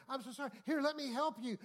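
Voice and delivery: high, loud, over-eager helpfulness; imitated gushing concern; mock frantic apology. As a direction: play someone fussing to make amends. Woman fussing over man she insulted.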